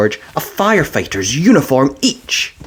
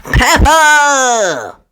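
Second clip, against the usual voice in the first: high, ringing call of the name; excited announcement; 13 semitones higher, 5 dB louder than usual. A narrator's reading. Miss Rabbit calling out the prize winner, excited.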